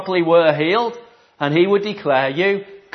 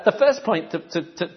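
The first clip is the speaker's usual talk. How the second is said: ordinary delivery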